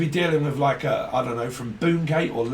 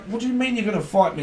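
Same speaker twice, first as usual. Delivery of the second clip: mock retort; 7 semitones higher, faster than usual. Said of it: "It's like".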